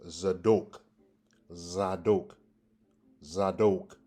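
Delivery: Zadok with a long O in the last syllable; first pronunciation. Shown as a demonstration. Teacher gives the first pronunciation, Zadok repeated with long O. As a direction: say Zadok slowly apart, ending "doke". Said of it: "The first one is".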